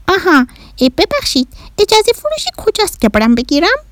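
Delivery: put-on high, innocent alien child; little Martian boy. Bahram asking where permission is sold, little Martian voice. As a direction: ask naively in a piping voice. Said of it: "بهرام کوچولو گفت".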